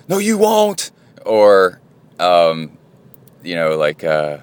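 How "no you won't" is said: challenging. Retold or imitated. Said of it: "challenged him, like".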